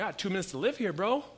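hammed-up panic of a dying man; mock dying urgency. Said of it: "I'm like".

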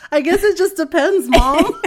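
high, loud, amused mimicry of kids; laughing retold quote. Sisters answering mom's odd question, retold laughing. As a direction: play the daughters' reply through laughter. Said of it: "my sister were like".

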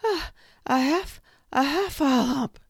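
high, loud, panting squeak; breathless Piglet. A narrator's reading. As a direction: blurt it out still out of breath. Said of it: "breathing so hard that he could hardly speak".

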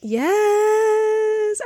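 long high-held yes; drawn-out, high-pitched delight; delighted agreement. Real, not acted. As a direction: cheer the yes and stretch it out. Delighted reaction to friend's rhyme.

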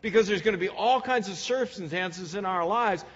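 fervent preacher pressing the point home; preaching at full force. Acted. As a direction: preach with building urgency. Preaching climax.

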